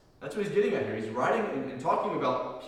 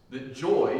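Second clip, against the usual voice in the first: joy punched louder; stress on joy; 6 dB louder than usual. Real, not acted. Preacher stressing the key word of his point, emphasis on joy. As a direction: lean firmly on the word joy. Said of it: "emphasizing the word joy there".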